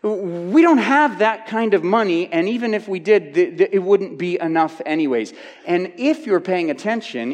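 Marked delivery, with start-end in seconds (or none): 0.0-5.3 much higher, louder exasperated protest; protesting disciples. Retold or imitated. Disciples objecting to Jesus about money, higher and exasperated. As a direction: argue it can't be done. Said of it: "their point is".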